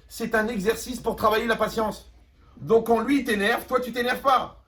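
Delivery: loud, driving sermon voice; preaching at full force. Acted. Rabbi pressing his listeners on anger, preaching loudly. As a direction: hammer the lesson home to the crowd.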